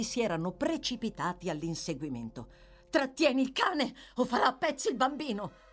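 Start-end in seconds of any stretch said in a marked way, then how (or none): 2.9-5.6 raised, alarmed cry; high-pitched urgent plea; urgent shout. A narrator's reading. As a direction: call out a desperate warning. Nancy shouts to save the child.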